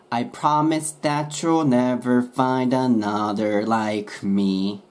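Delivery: slow, linked "I promise that you'll never find another like me"; slow linked demo. Shown as a demonstration. Teacher modelling the full lyric slowly for learners. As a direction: let every sound flow together slowly. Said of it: "くっついて".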